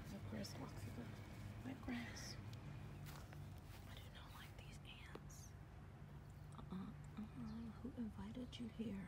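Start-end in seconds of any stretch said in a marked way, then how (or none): 3.9-5.3 fast, hushed whisper; nervous whispered aside. Real, not acted. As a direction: whisper it as if the ants could hear. Whispering fear of ants outdoors.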